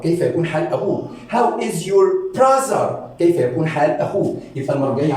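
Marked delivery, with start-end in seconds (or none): 1.3-3.1 higher, very slow teaching question; slow, lifted language-lesson delivery; slow modelling. Shown as a demonstration. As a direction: stretch each word out for learners.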